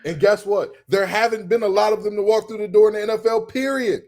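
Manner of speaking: high, loud, worked-up rant; heated rant. Real, not acted. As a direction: keep it high and insistent. Heated rant about greats.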